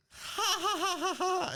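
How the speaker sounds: high hammed-up evil cackle; villainous laugh. Retelling Superman laughing to himself after framing Batman, in an evil cackle. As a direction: cackle like a smug comic villain. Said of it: "he starts laughing to himself".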